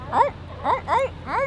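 high barking seal calls. Pretend. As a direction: bark out like a seal. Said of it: "That was my seal impression".

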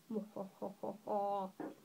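low mock "mwa-ha-ha-ha" laugh; put-on chortle. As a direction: chortle in low, evenly spaced hoh-hohs. Mock laugh after showing off German.

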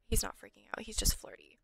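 amused whispered aside. Real, not acted. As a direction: whisper it like gossip. Whispered aside about Bob.

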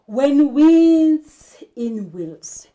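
slow and loud, leaning on each w in "When winds in wheels"; drawn-out alliterative line, each w stressed; stressed repeated w sounds. Shown as a demonstration. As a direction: hit every w in "winds" and "wheels" hard. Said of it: "can you see the repetition there of we".

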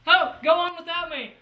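loud desperate call. Acted. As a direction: shout it to his friends. Wounded Athos calls out.

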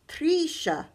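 high, loud call across a fairground; grandma calling Trisha over the noise. A narrator's reading. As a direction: call a child's name from afar. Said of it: "I heard my bubby call out".

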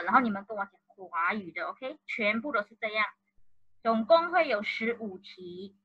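usual speaking voice

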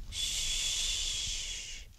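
long, hushed shush; drawn-out whispered shh; soft shushing. A narrator's reading. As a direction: gently hush a child. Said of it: "fece la nonna".

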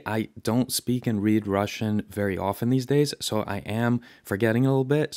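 ordinary talk

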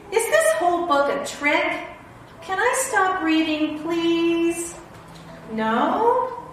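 hammed-up whiny protest; mock pleading. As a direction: plead like a trapped reader. Reader begging to stop.

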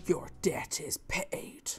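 slow, breathy whispered mock blessing; imitating a whisper. Mocking Rev's words to the chat in a slow whisper. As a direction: breathe it out slowly like a solemn pastor. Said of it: "and Rev was like".

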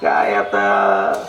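very low, very slow, sighing; weary husband. Retold or imitated. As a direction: drag it out like a fed-up husband. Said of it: "प्रत्येक पुरुषाचं मत काय असतंय". (खूप खालच्या आवाजात, कंटाळलेल्या नवऱ्यासारखे सावकाश)

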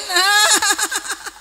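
high rippling laugh. Real, not acted. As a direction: burst into a run of high laughter. Laughs after teasing Pak Ci. (tertawa lepas bernada tinggi)

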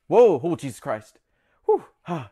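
gasping at a sudden scare; nervous startle. Real, not acted. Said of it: "but I am nervous".

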